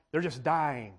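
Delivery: ordinary talk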